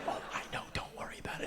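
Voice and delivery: hushed confidential whisper; whispered reassurance. Retold or imitated. Mother lets the accused man know, reassuring whisper. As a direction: whisper it as a quick aside.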